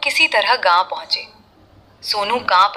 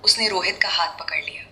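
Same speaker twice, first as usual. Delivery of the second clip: usual speaking voice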